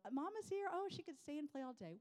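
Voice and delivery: high-pitched mock delight; playfully high mommy voice; proud mom gushing. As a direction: gush proudly over your child.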